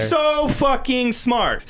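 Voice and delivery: high, drawn-out mock awe; sarcastic admiration. Sarcastic praise of Hitler as genius.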